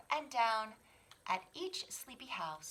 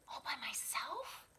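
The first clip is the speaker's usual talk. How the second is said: high, scared little whisper; timid cub whispering; 7 semitones higher, 4 dB quieter than usual. Acted. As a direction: whisper it, half afraid. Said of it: "whispered Sam".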